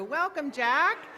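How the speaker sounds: loud, slow, beaming welcome; cheery loud welcome. Real, not acted. Greeting new AV guy loudly.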